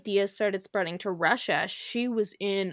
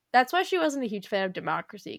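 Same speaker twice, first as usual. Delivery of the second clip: ordinary talk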